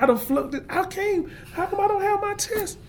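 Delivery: a scared student sure he failed; near tears. Retold or imitated. Student in class thinking he flunked, near tears. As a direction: pitch climbing very high, panicky and close to crying. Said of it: "I was about to cry in class".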